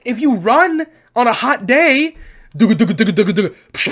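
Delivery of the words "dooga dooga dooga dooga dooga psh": bouncing noise. Retold or imitated. Imitating balls bouncing while running.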